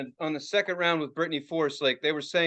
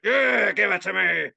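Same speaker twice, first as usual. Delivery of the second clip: put-on voice of a fume junkie; mock a die-hard begging for fumes; crazed fan; 2 semitones higher, 4 dB louder than usual. Imitates fans relishing the fumes.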